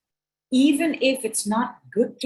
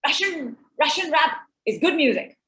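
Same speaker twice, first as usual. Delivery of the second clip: imitating son; 5 semitones higher than usual. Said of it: "And he's like".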